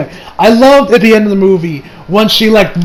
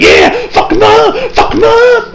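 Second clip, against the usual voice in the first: much higher, slower excited shout; cheering; 14 semitones higher, slower than usual. Retold or imitated. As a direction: cheer the hero on from your seat. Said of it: "I was cheering on".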